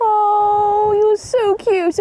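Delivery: high, loud, drawn-out gushing; cooing. Real, not acted. Owner fusses over the animals, cooing high and slow. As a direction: gush slowly over adorable animals.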